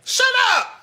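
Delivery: high, loud shout; exasperated yell. Acted. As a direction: yell it, fed up. Snapping at the crowd.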